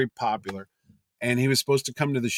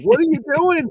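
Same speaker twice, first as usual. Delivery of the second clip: exasperated disbelief; 6 dB louder than usual. Retold or imitated. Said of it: "I'm like".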